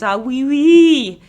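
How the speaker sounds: put-on slow, drawn-out French accent. Host playing up Maria's French accent, French accent. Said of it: "even has a hot French accent".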